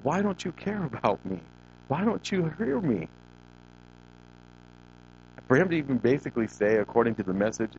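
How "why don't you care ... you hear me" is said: high, drawn-out cry without volume; mock anguished scream. Preacher voicing David screaming to God, high restrained wail. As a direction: act out a desperate scream, held back in volume. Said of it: "And he's screaming".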